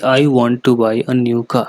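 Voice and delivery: slow even Indian English, no stress on any word; Indian English flat tone. Shown as a demonstration. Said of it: "हम उसे इस तरीके से बोलते हैं".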